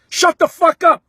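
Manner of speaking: retold angry shout, words punched; retold screaming. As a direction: imitate a player hollering at a heckler. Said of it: "screaming, hollering".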